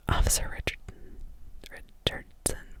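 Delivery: whispered address with "Officer" emphasized; stress on Officer. A narrator's reading. Judge addresses the witness, putting emphasis on "Officer". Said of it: "emphasizing the word officer".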